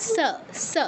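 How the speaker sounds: "স" as English s; dental sa. Shown as a demonstration. Teacher likening it to English S, "স" as sa. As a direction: hiss "স" as sa at the teeth. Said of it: "এসের সাথে তুলনা করবেন".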